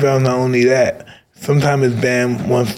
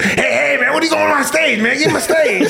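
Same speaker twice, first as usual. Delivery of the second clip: high, shouting [name] voice with laughter; [name] impression; 11 semitones higher than usual. [name] shouting at [name] to get off stage, high. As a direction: yell like angry [name] reclaiming the stage. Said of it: "Great, great impression".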